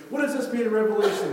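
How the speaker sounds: loud, high-pitched pestering question; urgent questioning. Retold or imitated. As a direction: imitate an over-eager convert demanding answers. Convert pressing harder with questions.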